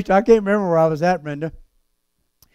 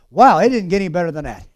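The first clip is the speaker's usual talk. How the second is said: usual speaking voice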